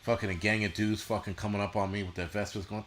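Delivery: normal talking voice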